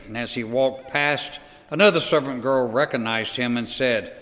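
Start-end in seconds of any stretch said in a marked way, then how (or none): none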